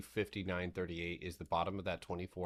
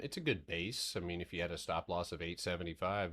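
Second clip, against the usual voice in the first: usual speaking voice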